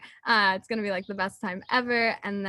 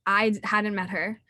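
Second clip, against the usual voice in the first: normal talking voice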